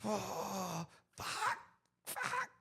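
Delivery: high, thin, soft squeaked curse; reliving despair, squeezed high and quiet. Retold or imitated. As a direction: choke the curses out small and high. Recalls breaking down in despair.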